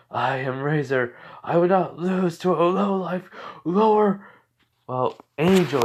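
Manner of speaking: high, loud, arrogant ranting in another voice; proud angry boast. Retold or imitated. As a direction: puff up and shout your pride. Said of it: "as then he goes".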